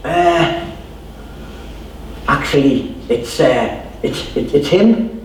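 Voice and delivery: hesitate, then sheepishly point him out; reluctant admission. Acted. Crowd admitting to Jesus who called, hesitant and sheepish.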